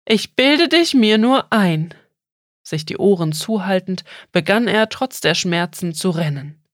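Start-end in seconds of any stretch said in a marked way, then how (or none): 0.1-1.9 louder and slow, pressing each word; desperate denial. Acted. Boy covers his ears and shouts at the turtle, slow and desperate. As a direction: insist hard to make it go away.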